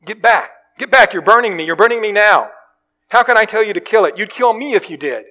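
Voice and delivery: high, loud cries of a burning ghost; ghost in pain. Acted. Ghost man crying out at the angel burning him, loud and high. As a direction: recoil and yell at the burning angel.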